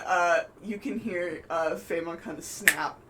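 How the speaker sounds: ordinary delivery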